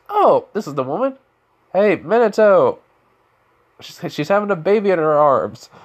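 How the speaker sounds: high, loud call across the house; hammed-up surprise, calling out the news; surprised announcement. Naruto announces the woman to Minato.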